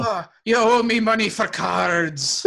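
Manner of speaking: high, slow hammed-up Irish accent; badly faked old-time Irish, drawn out; terrible Irish accent. Past-life vampire demanding card money, terrible high Irish accent. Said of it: "in the terrible Irish accent".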